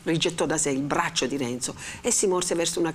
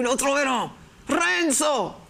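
sharp, much higher stern cry of the name; severe rebuke; 9 semitones higher, slower than usual. A narrator's reading. The friar grips Renzo's arm to stop his raving, severe. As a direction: cut him off hard. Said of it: "più severamente".

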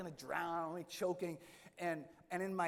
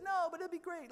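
higher, louder cheery reassurance; breezy Father Mike; 14 semitones higher, 5 dB louder than usual. Retold or imitated. Father Mike brushing off the worried priest, cheery and higher. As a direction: sell the trip with easy enthusiasm. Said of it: "He said".

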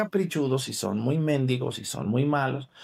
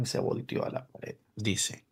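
dicho en susurro; 3 semitones lower, 9 dB quieter than usual. Real, not acted. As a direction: bajar la voz a un susurro.

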